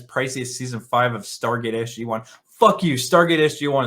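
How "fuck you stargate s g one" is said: angry outburst. Real, not acted. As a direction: bark it back at the reviewer. Defending Stargate against review jab.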